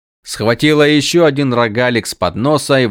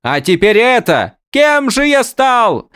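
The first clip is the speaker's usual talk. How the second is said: high character voice; 13 semitones higher, 4 dB louder than usual. Acted. Windel voiced, high far voice.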